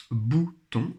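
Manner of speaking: "bouton" split into bou, pause, ton; syllable by syllable. Shown as a demonstration. Teacher pointing out a sound in the word, syllable by syllable. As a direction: sound out "bouton" in two beats. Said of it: "il fallait colorier l'être qui".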